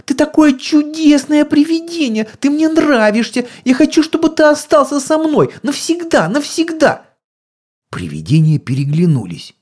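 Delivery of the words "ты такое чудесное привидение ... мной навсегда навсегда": put-on squeaky little girl. A narrator's reading. Little daughter keeps speaking.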